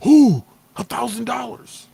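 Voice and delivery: high, loud, slow ooh of greed; greedy excitement. Retold or imitated. Seeing found money, greedy high ooh. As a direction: eye the money hungrily. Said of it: "the first thought they have is".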